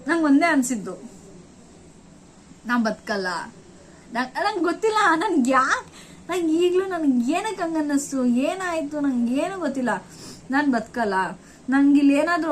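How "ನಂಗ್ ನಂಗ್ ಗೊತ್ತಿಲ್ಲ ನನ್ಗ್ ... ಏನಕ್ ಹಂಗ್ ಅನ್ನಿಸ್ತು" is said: ordinary talk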